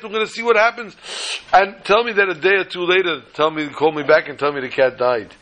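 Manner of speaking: high, hammed-up gentle breaking of bad news; gentle telling. Shown as a demonstration. Brother showing how to break bad news gently, high mock-gentle. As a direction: ease the blow step by step, mock-tender. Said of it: "Speak softly".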